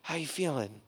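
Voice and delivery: enemy softly telling the patient not to repay; gentle enemy. Retold or imitated. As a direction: reassure him it's covered. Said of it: "he is the one who's like".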